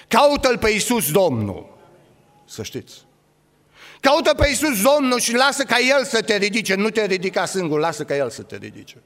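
loud, rising preacher's call; fiery preaching. Acted. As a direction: preach it out with full force to the church. Preacher calling out loudly.

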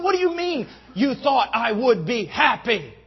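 loud, high-pitched indignant shout; very angry. Retold or imitated. Angry man answering the preacher who thought he'd be happy, shouting. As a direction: explode in outraged disbelief. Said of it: "he got very angry".

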